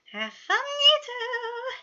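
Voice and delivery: very high, loud, slow drawn-out taunt; teasing sing-song. A narrator's reading. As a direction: drag it out like a cheeky twin. Twin teasing as he leaves.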